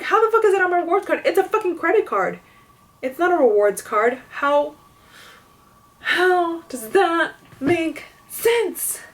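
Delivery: high-pitched retold outrage, rushing then stretched out; acted incredulous protest; indignant disbelief. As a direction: argue back in exasperation, slowing for the final question. Acting out angry disbelief at cashier.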